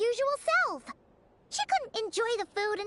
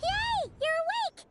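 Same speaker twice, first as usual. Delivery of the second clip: excited; 9 semitones higher, slower than usual. Acted. Paimon greets waking Traveler.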